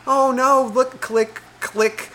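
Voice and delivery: high-pitched, unbothered imitation; mock cooing partner. Storyteller mimicking partner ignoring warnings, high cooing.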